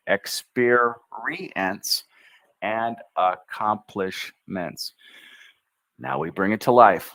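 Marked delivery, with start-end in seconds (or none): 0.1-2.0 Experience very slow, leaning on the R; push into the R. Shown as a demonstration. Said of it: "Push in with the R". 2.6-4.9 slow syllables. Shown as a demonstration. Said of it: "practice them slowly".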